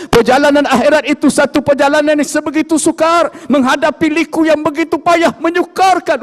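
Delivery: high, loud impassioned warning; earnest dying Ali. Acted. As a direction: make them feel how hard the road is. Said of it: "Sayyidina Ali berkata".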